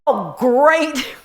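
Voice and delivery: high, loud mock husband, sauce-covered; hammed-up dry oh great; husband's line. Sauce-splattered husband to wife, mock dry sarcasm. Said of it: "he was like".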